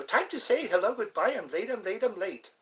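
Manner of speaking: a hint of the hurried cartoon rabbit running late. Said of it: "That was the cartoon version".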